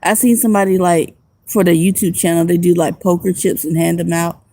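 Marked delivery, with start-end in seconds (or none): none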